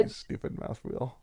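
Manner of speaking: hushed irritated grumble; muttering curse. Real, not acted. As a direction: curse under your breath. Cursing the scroll wheel.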